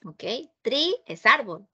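ordinary talk

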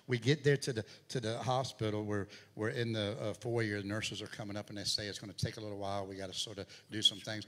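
loud, driving sermon delivery; preaching at full force. Acted. Pastor preaching his daughter's hospital story, loud and driving. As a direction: push the story out to the congregation.

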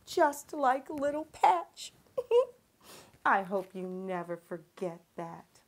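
plain reading voice

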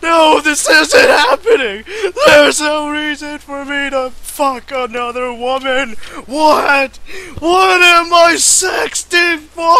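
crying, shouting in mock horror; panicked wailing. Lover dead beside him, he wails in mock despair. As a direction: wail in hammed-up panic at what he did.

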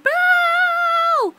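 very high, loud shouted name; scream. Retold or imitated. Fan calling the director over, full scream. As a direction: scream his name to make him turn. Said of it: "so I just screamed".

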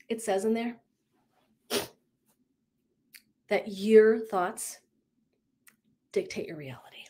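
whispered like a secret, slowing down; conspiratorial whisper. Retold or imitated. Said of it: "she's conspiratorial asking me".

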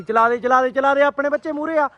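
high-pitched eager calling out; excited urging. Real, not acted. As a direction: urge him on, excited and insistent. Urging friend to fire crackers. (ਜੋਸ਼ ਨਾਲ ਉੱਚੀ ਸੁਰ ਵਿੱਚ ਹੱਲਾਸ਼ੇਰੀ)

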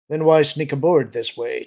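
loud, sharp demand. A narrator's reading. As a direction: confront him loudly as the officer. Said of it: "Tierce demanded".

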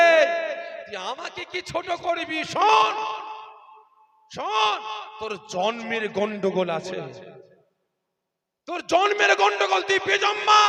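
high, loud furious outburst; furious shout. Acted. The Prophet shouting at the insulter, furious shout. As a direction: roar the insult back. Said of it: "কান্না বন্ধ করে বলে".

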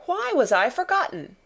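put-on high, indignant child's demand; a sulky child's outrage, pitch up; stamping her foot. Mary stamping her foot at the officers, indignant. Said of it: "stamping her foot".

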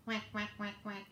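soft low quacks, a touch of a duck; mother duck quacking. Acted. Mother duck calling her ducklings, soft low quacks. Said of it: "Mother duck said".

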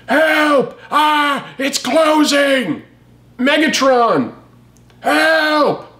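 high, hammed-up calls for help; cartoon robot crying out as the portal closes; mock panicked cries. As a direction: wail like a villain being sucked away. Megatron being sucked into portal.

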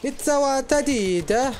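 high, drawn-out mock Italian accent. Joking with a gaming mate, mock Italian accent. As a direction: put on a slow, high-pitched Italian accent. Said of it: "not expecting the Italian accent".

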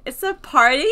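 loud delighted surprise; loud excited question. Real, not acted. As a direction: gasp it out thrilled. Excited discovery.

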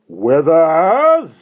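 long, high, rising yell; strangled shout. A narrator's reading. Mister Hastings summons his henchman, strangled shout. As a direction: bellow the name, strangled. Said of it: "let out a strangled shout".

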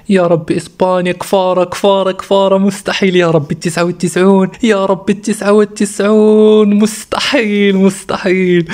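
high-pitched, stretched cries of amazement; thrilled disbelief. Real, not acted. Streamer pulling a 99 card in front of viewers, stunned and thrilled.

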